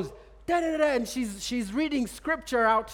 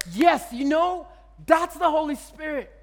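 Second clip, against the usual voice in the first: higher and louder, bursting with joy; excited declaration; 5 semitones higher, 5 dB louder than usual. Retold or imitated. Preacher tells the smoker the heat is the Holy Spirit, excited. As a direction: announce it triumphantly.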